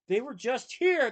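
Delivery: loud slow wail. A narrator's reading. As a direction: wail it out in frustration. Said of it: "the chief wailed".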